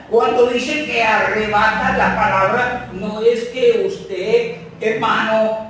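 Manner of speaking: loud and high, slowed, preaching hard; full-force sermon voice; forceful preaching. Acted. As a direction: drive the point home to the congregation.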